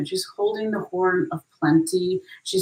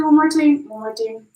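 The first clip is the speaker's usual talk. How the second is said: a slip into a mock Jamaican accent, higher and louder at first; accidental Jamaican lilt; 4 semitones higher, 6 dB louder than usual. Real, not acted. Tarot reader wrapping up for Capricorn, slipping into a Jamaican accent. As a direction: blurt it out in an unintended Jamaican accent. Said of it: "I'm not Jamaican. I don't know why I said it like that".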